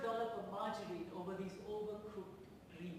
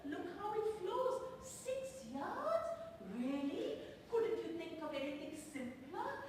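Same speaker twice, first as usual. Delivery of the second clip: high, drawn-out cooing amazement, questions lifting; gushing onlookers; 6 semitones higher, slower than usual. Acted. Party guests stare at her sari like a sideshow, gushing and condescending. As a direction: marvel condescendingly at an exotic curiosity. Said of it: "treated me like a circus sideshow".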